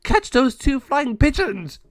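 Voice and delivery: high, loud, slow gargoyle yell; gargoyle shout. Acted. El Jago yells at gargoyles to catch the birds. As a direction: bellow the order to the others.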